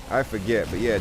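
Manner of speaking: ordinary delivery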